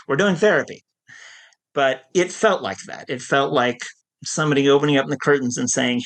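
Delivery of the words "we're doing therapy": lightly imitated Long Island therapist, pitched up; amused correction in a rough Long Island accent; a hint of bad Long Island, higher voice; Lee's Long Island accent. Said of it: "she would laugh and say".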